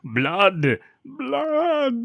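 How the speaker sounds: high, loud horror screams; mock scream. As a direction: shriek it like a B-movie victim. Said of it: "she removes the covers".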